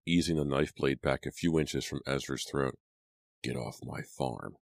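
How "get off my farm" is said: slow, quiet, menacing order; low growl. A narrator's reading. Man orders intruders off his farm, growling low. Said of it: "he growled".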